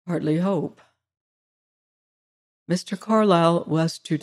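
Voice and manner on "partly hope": a touch of tragedy, softer, "partly hope" falling; "partly hope" said softer with a mild tragic fall; tragic note. A narrator's reading. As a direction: let "partly hope" sink with quiet, understated tragedy. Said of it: "something more nearly tragic in his voice".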